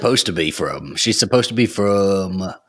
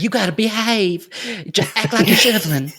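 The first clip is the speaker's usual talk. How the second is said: put-on a sweet Southern accent, pitched up high; [name]'s Southern accent; 11 semitones higher than usual. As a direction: play the Texas girl telling him to be a gentleman. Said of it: "I tried putting on an accent".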